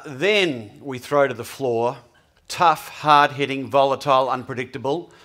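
oversell how tough the crowd is; mock dramatic build-up. Joking about the tough audience.

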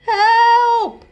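high, loud holler. Acted. Flynn falls into pool, loud high holler. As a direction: holler for help. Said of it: "Flynn hollered".

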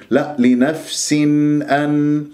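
نون of أن sounded clearly, izhar; clear nun. Shown as a demonstration. Teacher shows clear nun after a wrong take. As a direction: make the ن in لنفس أن fully clear. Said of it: "جبت النون المظهرة".

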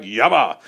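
"Yabba" said low and short, two quick syllables; tongues syllables demo. Shown as a demonstration. Preacher shows the congregation his first two syllables, low and short "Yabba". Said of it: "that's how I resisted him, and then I'd".

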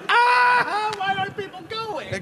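high, exasperated wail at slow drivers; over-the-top road-rage whine; mock traffic rant. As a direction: howl at traffic like a fed-up passenger. Imitating his rant at freeway traffic.

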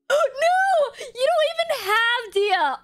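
high, real cry of amazement; thrilled disbelief. Real, not acted. Streamer telling Haley she lacked Dia, amazed high cry. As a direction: exclaim in shock, pitch leaping up. Still reacting to double pull.

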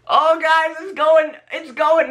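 high, urgent excitement; excited announcement. Real, not acted. Vlogger telling viewers it works, high and excited. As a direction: call it out high and eager.

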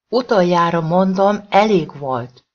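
loud bellowed ultimatum; roaring. Acted. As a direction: roar it as a final warning. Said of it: "ordította".

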